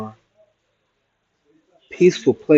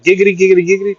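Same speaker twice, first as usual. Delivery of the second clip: giggity catchphrase; 6 semitones higher, 3 dB louder, much faster than usual. Pretend. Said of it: "catchphrase impression".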